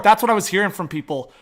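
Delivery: ordinary delivery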